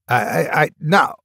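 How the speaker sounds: halting, stammered denial; hissed. A narrator's reading. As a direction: stumble into an unconvincing no. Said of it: "he hissed".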